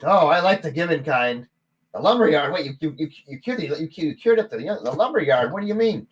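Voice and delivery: high, loud surprised gnome; a startled little barkeep. Acted. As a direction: barkeep stunned at the cleared lumberyard.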